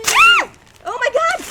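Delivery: very high delighted shriek; excited scream. Real, not acted. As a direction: shriek with joy. Chocolate surprise scream.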